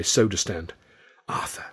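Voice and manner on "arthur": hissed, soft and breathy; urgent hiss. Acted. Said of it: "Charlie hissed urgently".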